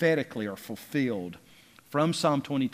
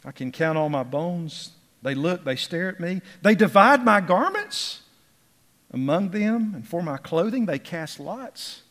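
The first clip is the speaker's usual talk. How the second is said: high, loud emphasis on key phrases; impassioned pulpit recitation in bursts; fervent scripture reading; 6 semitones higher, 6 dB louder than usual. Acted. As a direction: declaim the psalm, pitch leaping up. Reads the psalm aloud fervently.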